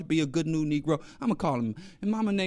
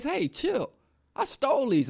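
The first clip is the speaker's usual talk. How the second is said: put-on higher, softer casual young man; lightly voiced kid shrugging it off; young man brushing off; 7 semitones higher, 3 dB quieter than usual. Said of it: "And he says".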